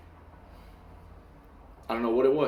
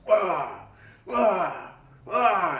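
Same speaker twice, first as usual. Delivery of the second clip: quick sharp "ah" bursts, higher pitched; imitated whipping grunts; 6 semitones higher, much slower than usual. Son mimicking dad's blows, grunting imitation. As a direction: act out each swing with a short cry.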